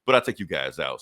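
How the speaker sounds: low, quick offhand villain; lightly voiced smug Lex Luthor. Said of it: "Luthor's like".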